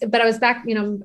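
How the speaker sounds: usual speaking voice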